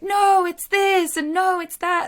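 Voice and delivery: high, slow, loud know-it-all protest; hammed-up objecting listener; mock listener objection. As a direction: mimic a listener who insists they know better. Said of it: "listeners going like".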